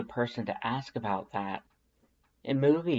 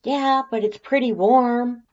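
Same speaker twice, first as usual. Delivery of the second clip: much higher and louder, brash tomboy voice; Undyne's brash voice; 13 semitones higher, 9 dB louder than usual. A narrator's reading. Undyne calling back through the door, loud and much higher. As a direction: belt it out like a cocky warrior woman.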